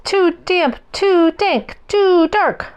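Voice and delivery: high, tiny squeaky mouse voice; a tiny mouse fretting at the cold cave. A narrator's reading. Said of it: "Mouse squeaks".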